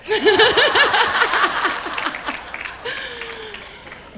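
big, loud burst of laughter; long laugh that trails off; hearty laugh. Real, not acted. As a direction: crack up at your own joke. Laughs at her own punchline.

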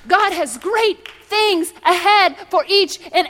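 high, loud preacher's cry, building; full-force preaching. Acted. Preacher lifting the congregation with God's word, in full-force preaching. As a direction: preach it like God's own promise.

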